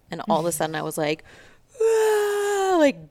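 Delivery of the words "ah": loud, high mock wail; imitated bawling. Retelling her breakdown at the wheel, mock bawling. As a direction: wail it out like a sudden sob.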